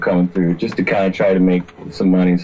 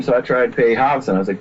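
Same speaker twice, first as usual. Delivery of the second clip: normal talking voice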